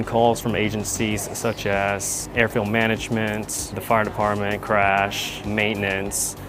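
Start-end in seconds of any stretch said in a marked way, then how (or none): none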